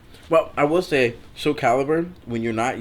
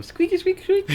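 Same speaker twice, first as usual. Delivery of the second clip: squeaky high voice. Retold or imitated. Imitating squeaky horror sound.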